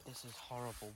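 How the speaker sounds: ordinary talk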